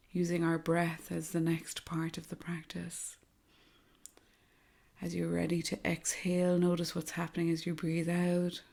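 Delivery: soft, hushed meditation voice with long pauses; guided meditation. Acted. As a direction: guide them gently through each breath, softly and unhurriedly.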